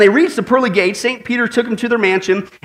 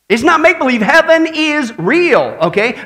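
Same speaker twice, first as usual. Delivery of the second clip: higher, emphatic sermon declaration; forceful preaching; 5 semitones higher, 3 dB louder than usual. Acted. Pastor assuring the congregation heaven is real, forceful preaching. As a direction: drive each word home to the crowd.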